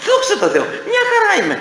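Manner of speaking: high, bright, laughing reply; smiling cheerfully. Acted. Sick man cheerfully telling a friend he is fine, smiling. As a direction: answer through a smile. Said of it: "Χαμογελαστά".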